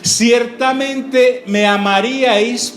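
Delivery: very slow, measured, drawn out; slow solemn quoted Jesus. Acted. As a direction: intone it slowly like a revelation.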